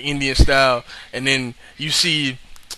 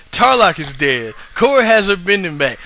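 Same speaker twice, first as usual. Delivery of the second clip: ordinary talk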